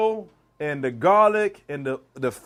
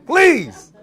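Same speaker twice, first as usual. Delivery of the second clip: laughing plea; 7 semitones higher, 9 dB louder, much slower than usual. Real, not acted. Warning viewers with a laugh.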